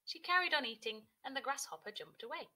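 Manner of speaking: ordinary delivery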